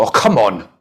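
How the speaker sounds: put-on loud, impatient outburst at a child; exasperated parent. Parent to child who missed the ball, exasperated.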